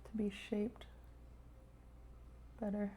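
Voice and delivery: whisper. Real, not acted. Muttering while piping a cake.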